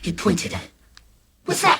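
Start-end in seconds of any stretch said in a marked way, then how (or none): 1.4-1.8 high, abrupt question; shocked dad. Acted. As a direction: demand it in startled disbelief. Dad stares at hole, cheeriness stops.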